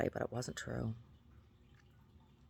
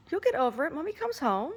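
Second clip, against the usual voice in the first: much higher sing-song reassurance. Real, not acted. Owner reassuring her sad dog, high baby talk.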